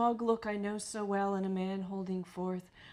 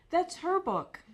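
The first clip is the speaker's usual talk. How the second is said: insistent interruption; 8 semitones higher, 3 dB louder, slower than usual. Retold or imitated. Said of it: "Sally interrupted him to say".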